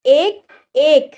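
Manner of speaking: एक, एक said slowly and deliberately; slow demo pronunciation. Shown as a demonstration. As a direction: repeat एक slowly for learners. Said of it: "इक्का". (धीरे-धीरे दोहराना)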